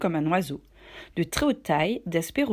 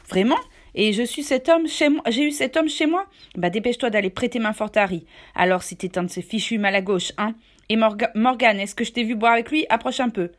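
feigned outrage; 3 semitones higher, 5 dB louder than usual. Acted. Silver faking outrage before the boy, loud and blustering. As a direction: put on indignant bluster.

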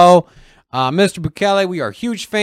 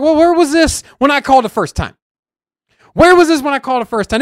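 indignant retort; 7 semitones higher, 4 dB louder, faster than usual. Retold or imitated. His indignant reply to insurer.

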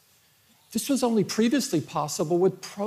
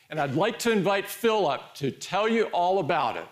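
ordinary talk

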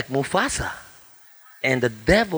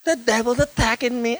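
whiny victim; 8 semitones higher than usual. Retold or imitated. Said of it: "oh, please".

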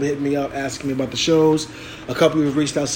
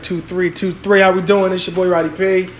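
ordinary talk

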